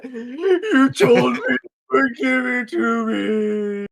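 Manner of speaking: slow, high, whiny mock plea; mock sob plea. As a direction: beg theatrically, dragging it out. Mock pleading in character, kneeling.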